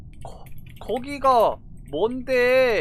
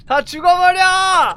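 higher and louder angry yell; angry shout; 7 semitones higher, 8 dB louder than usual. Real, not acted. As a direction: yell it in frustration. Shouted curse.

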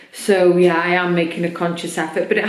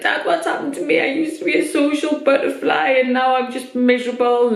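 tearful plea; 6 semitones higher than usual. Retold or imitated. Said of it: "then I just burst into tears".

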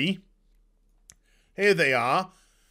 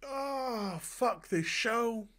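exasperated groan; 4 semitones higher, 10 dB quieter, much slower than usual. Real, not acted. Exasperated aside after rant.

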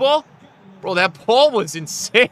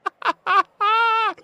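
laughter; 4 dB louder than usual. Real, not acted. Laughs after the goal.

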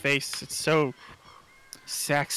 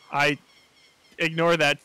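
ordinary talk, nothing marked

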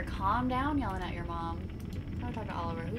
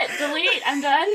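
laughing; 5 semitones higher, 9 dB louder than usual. Real, not acted. Embarrassed, laughing protest.

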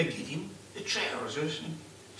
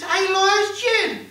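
high, loud and slow disbelief; stunned, drawn-out question; shocked; 9 dB louder, much slower than usual. Acted. Husband shocked at news.

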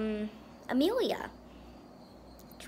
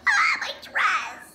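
mock wail; 4 semitones higher, 3 dB louder, slower than usual. Character's dress ruined, cries out.

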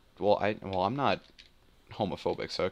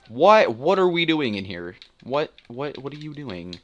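higher, louder, repeated confused "What?"; baffled outburst; 6 semitones higher, 6 dB louder, slower than usual. Real, not acted. Gamer confused by teammates' move, baffled outburst. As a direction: sound genuinely baffled.

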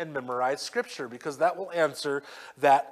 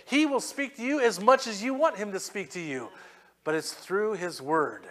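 ordinary talk

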